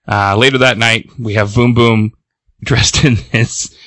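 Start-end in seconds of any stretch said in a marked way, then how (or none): none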